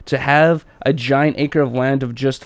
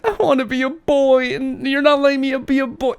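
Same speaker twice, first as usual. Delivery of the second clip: put-on high-pitched wailing kid voice; hammed-up upset little kid; mock crying child; 10 semitones higher than usual. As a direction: imitate a child pleading through tears. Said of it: "And she's crying".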